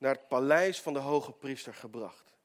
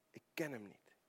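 much softer, grave repetition of "Ik ken hem niet"; hushed denial; 4 semitones lower, 13 dB quieter than usual. Retold or imitated. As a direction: let "Ik ken hem niet" fall nearly silent. Preacher repeats the denial sadly.

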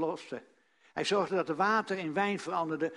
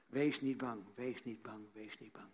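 each repeat softer, down to a whisper; fading to a hush; 7 semitones lower, 9 dB quieter than usual. Acted. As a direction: let the words die away gently.

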